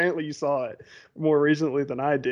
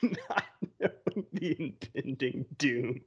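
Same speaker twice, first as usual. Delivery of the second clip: slow, quiet words through laughter; amused, softer echo broken by laughs; laughing; 2 semitones lower, 10 dB quieter, much slower than usual. Real, not acted. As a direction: repeat it slowly while cracking up. Laughing at the other's written note.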